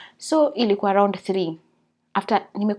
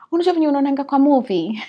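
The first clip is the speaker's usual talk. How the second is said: excited; 5 semitones higher, 7 dB louder, faster than usual. Real, not acted. Water broke, excited retelling.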